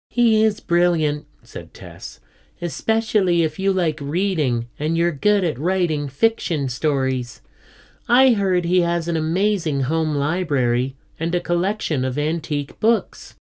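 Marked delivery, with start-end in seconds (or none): 0.1-1.3 lightly voiced keen pupil, higher pitch; a hint of an admiring schoolgirl; keen schoolgirl Tess. A narrator's reading. Pupil agreeing about her teacher, keen and higher. 2.6-13.4 lightly voiced keen pupil, higher pitch; a hint of an admiring schoolgirl; keen schoolgirl Tess. A narrator's reading. Pupil agreeing about her teacher, keen and higher.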